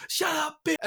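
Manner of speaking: high mock yell without extra volume; retold scream. Retelling crowd woman's outburst, high mock scream. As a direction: squeal it as an imitated heckler. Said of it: "woman starts screaming".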